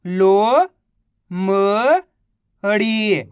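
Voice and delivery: लोमड़ी drawn out very slowly, syllables split; slow phonics sounding-out. Shown as a demonstration. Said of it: "तो मिलके बन जाएगा".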